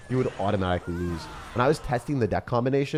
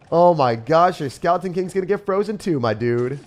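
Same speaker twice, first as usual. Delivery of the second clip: higher, louder gleeful teasing; excited taunt; 7 semitones higher, 7 dB louder than usual. Real, not acted. Streamer taunting the opponent, louder and gleeful. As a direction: rub it in with delight.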